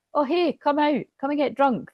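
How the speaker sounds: high, coaxing call to come out; drinking buddy. Acted. Mate tempting the masculine out drinking, high and eager. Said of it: "totally giving the energy of".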